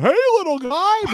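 high, loud cheery hey little guy; loud greeting. Retold or imitated. Heard from outside the bathroom, greeting it loudly. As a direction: call it out brightly like to a pet. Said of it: "you could hear him going".

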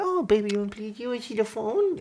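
soft, high-pitched cooing; baby talk. Retold or imitated. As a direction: coax a little baby sweetly.

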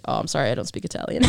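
quick, low-key deadpan quote; straight-faced. Retold or imitated. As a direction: toss it off softly, mock serious. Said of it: "it's really straight face".

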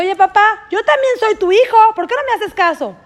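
pleading cry. Acted. Son pleading with ignoring father.

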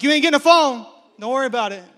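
father's playful loud no; firm refusal. Retold or imitated. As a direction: put your foot down loudly. Retold dad putting foot down.

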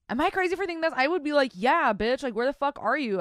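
loud, high, indignant rant; worked-up outburst. Acted. Host imagines confronting a missing man, loud and outraged. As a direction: raise your voice as if confronting him.

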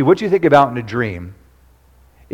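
ordinary talk, nothing marked

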